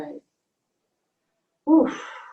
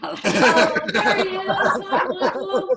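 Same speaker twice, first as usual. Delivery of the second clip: delighted laughing hello, pitched up high; laughing greeting; 7 semitones higher, 7 dB louder, much slower than usual. Real, not acted. Greeting an old friend on the video call, laughing. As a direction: greet him through a laugh.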